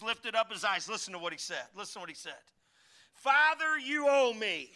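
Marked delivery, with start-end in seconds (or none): 3.1-4.7 loud, high demand to God; mock demanding prayer. Preacher mocking a prayer that orders God, loud. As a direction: demand payment from heaven.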